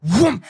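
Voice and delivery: sudden high whoomp of a blast; imitated explosion whoomp. Game master voicing the grenade going off, explosive whoomp. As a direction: burst out with a muffled-blast noise.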